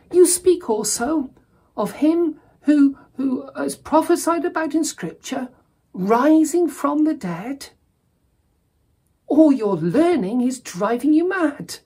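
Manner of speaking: a Roman governor ridiculing a prisoner; Festus scoffing at Paul. Acted. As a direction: scornfully tell Paul he's lost his mind. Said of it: "He's basically saying".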